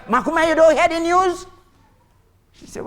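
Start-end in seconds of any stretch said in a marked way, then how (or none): 0.1-1.5 high, loud news-bringer; excited calling. Acted. Drunk Tony with news for Makume, excitedly calling out. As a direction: shout it up to the neighbour, high-pitched.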